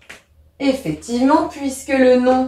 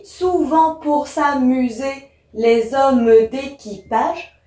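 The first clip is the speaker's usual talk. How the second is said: slow, syllable-by-syllable demonstration; verse drawn out beat by beat; counting syllables; 3 semitones higher, slower than usual. Shown as a demonstration. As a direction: split "souvent pour s'amuser, les hommes d'équipage" into slow, separate syllables. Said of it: "j'ai un vers de douze syllabes".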